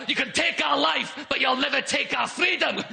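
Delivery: high-pitched mock Scottish rebel cry; defiant Scot. Scots defying the English, mock defiant cry. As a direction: declare it high, like a rebel rallying troops. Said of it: "You Scots were saying things like".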